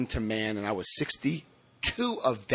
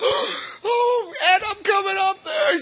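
put-on high shrieking old lady voice; high outraged elderly shriek; shocked old lady; 4 dB louder, much slower than usual. Neighbor shocked at confession.

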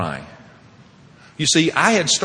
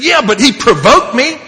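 high, loud self-justification; defensive protest; 11 semitones higher, 8 dB louder than usual. Retold or imitated. Father justifying himself to his sponsor, acted defensive shout. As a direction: argue back in mock outrage.

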